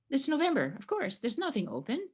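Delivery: ordinary talk, nothing marked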